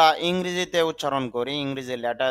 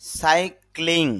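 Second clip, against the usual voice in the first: slow model word; 3 semitones higher, much slower than usual. Shown as a demonstration. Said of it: "introducing word slowly".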